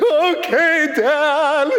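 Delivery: very slow, high mock bawling; hammed-up crying kid owning up; tearful confession. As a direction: imitate a boy wailing as he confesses. Son tearfully confesses to Dad.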